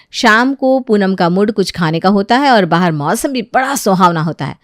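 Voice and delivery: plain reading voice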